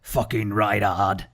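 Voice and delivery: a Bob Hoskins style Cockney growl; Cockney accent. Pretend. Gamer trying a Bob Hoskins Cockney accent on his army. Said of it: "I don't know if that's a good accent".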